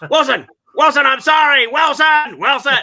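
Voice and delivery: loud, high anguished shouting of a name; Tom Hanks Castaway impression. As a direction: wail the name like a castaway losing his friend. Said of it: "do their best Tom Hanks Castaway impression".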